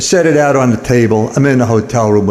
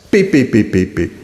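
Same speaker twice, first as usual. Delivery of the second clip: imitated detector beeps.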